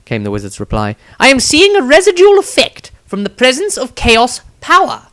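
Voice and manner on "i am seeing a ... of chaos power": entranced wizard. Acted. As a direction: speak as if seeing beyond the world. Wizard explains plainly.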